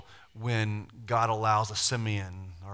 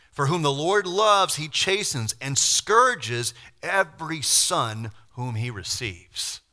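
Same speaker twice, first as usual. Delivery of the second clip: proclaiming scripture; 4 semitones higher, 6 dB louder, slower than usual. Acted. Preaching a scripture quote.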